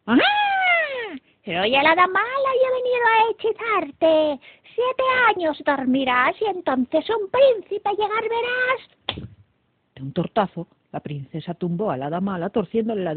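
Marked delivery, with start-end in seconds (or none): 0.1-9.3 high, loud witch casting a spell; theatrical villain's yell, drawn out at the end; shrieking evil fairy. A narrator's reading. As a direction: proclaim the curse in a shrieky fairy voice. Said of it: "y gritó".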